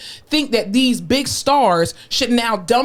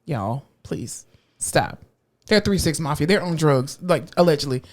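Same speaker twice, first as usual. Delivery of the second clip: low, soft, slow deadpan; weary plea; 8 semitones lower, 4 dB quieter, slower than usual. Acted. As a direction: plead wearily, letting the voice drop. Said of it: "I'm just like".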